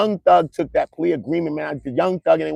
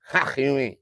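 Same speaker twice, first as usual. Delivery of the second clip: usual speaking voice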